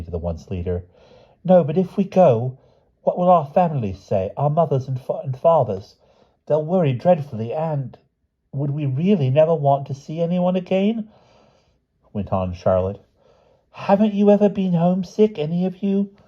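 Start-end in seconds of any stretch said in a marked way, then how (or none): 1.4-11.1 put-on high, pleading child character voice; worried Charlotte. A narrator's reading. Charlotte pleading with the other children about their families, worried. 13.7-16.1 very high, imploring character voice; pleading Charlotte. A narrator's reading. Charlotte asking the other children about homesickness, pleading.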